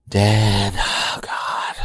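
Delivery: put-on eye-rolling teenager mortified by her dad; embarrassed teen. Dad imitating his teen daughter, exasperated groan.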